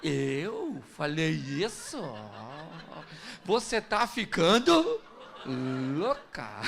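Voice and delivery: slow, mocking innocent denial; husband cynically denying to his wife. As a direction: play dumb with a smirk. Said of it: "com a cara mais cínica do mundo".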